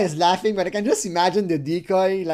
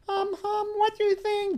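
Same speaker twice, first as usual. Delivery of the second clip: very high falsetto, mocking a clueless girl; squeaky airhead voice; mock girly voice. Mocking the decoy's unsure reply in falsetto.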